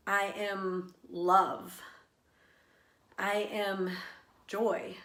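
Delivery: slow meditation-style affirmations, long pauses; guided affirmations. Shown as a demonstration. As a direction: lead the breath gently, word by word. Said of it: "started offering up different words".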